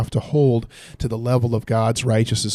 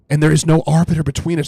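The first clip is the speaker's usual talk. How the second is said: higher and louder, pressing the point; emphatic; 5 semitones higher, 6 dB louder, faster than usual. Real, not acted. Preacher driving the verse home to listeners, emphatic and louder. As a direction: proclaim it with urgency.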